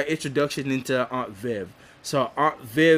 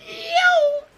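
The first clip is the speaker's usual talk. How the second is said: very high, drawn-out falsetto exclamation; hammed-up falsetto amazement; retold astonishment. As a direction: squeal it long and high in mock shock.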